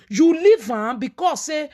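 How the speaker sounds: ordinary talk, nothing marked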